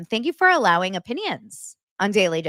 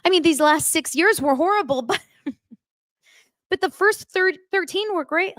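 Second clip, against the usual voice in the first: high-pitched imitation of a weepy man; hammed-up emotional confession in a raised voice; mock choked-up; 10 semitones higher than usual. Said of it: "he was like".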